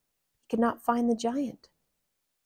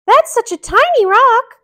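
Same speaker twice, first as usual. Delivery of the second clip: Mickey voice; 15 dB louder than usual. Acted. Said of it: "cried Mickey".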